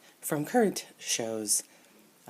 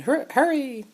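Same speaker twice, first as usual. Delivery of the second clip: high, loud, drawn-out call; mock urgent call; 12 semitones higher, 6 dB louder, slower than usual. Reading a toy's speech balloon, high mock urgent call. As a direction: call out like a cartoon figure. Reads toy figure's balloon.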